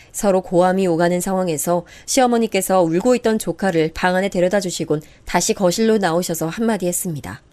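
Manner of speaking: usual speaking voice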